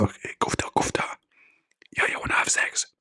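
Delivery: unvoiced secret whisper. Caller to angel, whispering secretly. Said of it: "why you are whispering".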